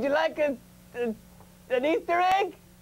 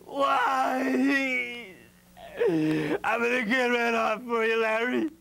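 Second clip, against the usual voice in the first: sobbing out the vow; crying. Acted. Grieving over hurt Larry, vowing revenge through sobs. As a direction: weep through the promise of revenge.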